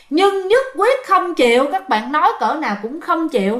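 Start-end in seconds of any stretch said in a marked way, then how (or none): none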